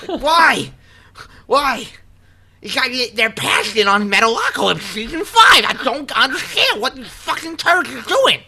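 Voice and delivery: high, loud, incredulous outburst; exasperated rant. Real, not acted. Fan ranting about his show's cancellation, loud exasperated yelling. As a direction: yell your disbelief, pitch way up.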